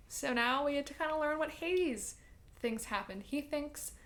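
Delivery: ordinary talk, nothing marked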